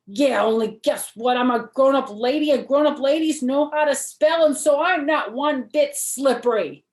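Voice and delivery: put-on high, loud, insistent little girl protesting; a kindergartner stamping her foot in words; small girl loudly insisting to her mother. Junie B retorts indignantly.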